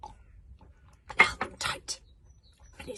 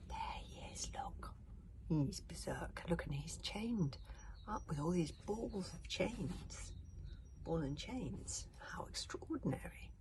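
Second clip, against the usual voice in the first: whispered awe among the watchers; hushed crowd whisper; 9 dB quieter, slower than usual. Acted. Spectators whispering in awe at the chained berserk. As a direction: breathe it like gawking spectators.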